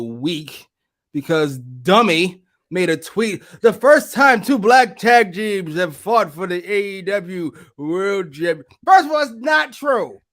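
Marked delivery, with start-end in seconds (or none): 3.6-8.6 loud, drawn-out mocking shout; mock shouted tweet. Reading out a tweet, mockingly outraged. 8.8-10.2 high, slow, loud protest; angry correction. Real, not acted. Angrily correcting the tweet.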